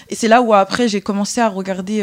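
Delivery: ordinary talk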